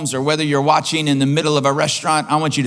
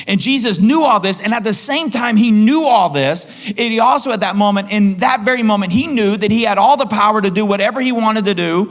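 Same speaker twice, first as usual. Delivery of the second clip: preaching at full force; 4 semitones higher than usual. Acted. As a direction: preach it, climbing with urgency.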